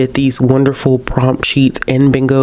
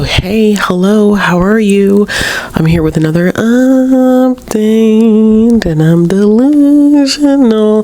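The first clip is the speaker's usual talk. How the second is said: much higher, louder hello, delusional drawn out slow; bubbly greeting; 10 semitones higher, 4 dB louder, slower than usual. Real, not acted. Vlogger greeting viewers at the start of an update, giddy and loud. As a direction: sing out the hello like a hyped host.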